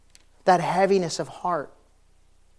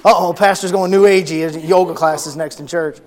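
mock alarm; 2 semitones higher, 11 dB louder than usual. Mocking imagined critics.

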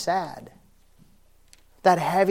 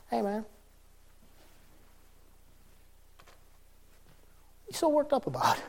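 gentle Jesus; 7 semitones higher, 5 dB quieter, faster than usual. Acted. Jesus voiced, gentle question.

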